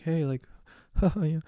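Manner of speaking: high, slow, nervous little voice with a laugh; a shy giggle to fill the silence; timid self. Retold or imitated. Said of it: "you know, I'm so timid".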